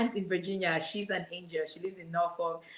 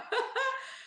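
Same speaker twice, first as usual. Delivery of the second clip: high giddy burst of laughter; emotional laugh. Real, not acted. Guest overwhelmed by the host's question, high giddy laugh. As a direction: laugh out, overcome with joy.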